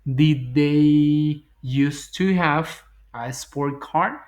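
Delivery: drawn out question; very slow demo. Shown as a demonstration. Teacher modelling question exercise answer, very slow. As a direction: stretch out Did they used to have slowly. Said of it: "Queda la segunda".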